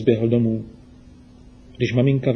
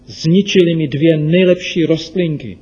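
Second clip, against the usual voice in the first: put-on higher and louder, a resentful boy; indignant; 5 semitones higher, 5 dB louder than usual. A narrator's reading. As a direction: glare while you complain. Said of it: "probodával očima své okolí". (rozhořčeně, výš a hlasitěji)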